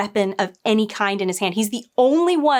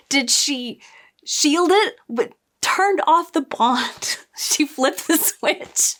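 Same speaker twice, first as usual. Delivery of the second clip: slow, raised, disbelieving; incredulous; 4 semitones higher, slower than usual. Real, not acted. Reviewer mocking the show's wording, slow and incredulous. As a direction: stretch it out in disbelief.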